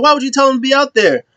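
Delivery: quick, high-pitched mock indignation; exasperated challenge. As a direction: fire back like a fed-up partner, fast and high. Woods challenges Kofi, retold.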